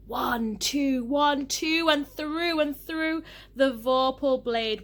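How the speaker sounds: high, loud, slowing sword strokes; battle excitement. A narrator's reading. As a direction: strike each count like a blow. Sword fight narrated in the poem.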